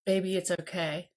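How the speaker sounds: put-on calm a crying child gently; hushed tender reassurance; soothing reassurance. Shown as a demonstration. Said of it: "my energy needs to say".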